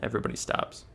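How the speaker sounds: soft, low storyteller pause; hushed suspense. A narrator's reading. Streamer narrates the frozen moment, soft and low. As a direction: hold the room in a quiet hush.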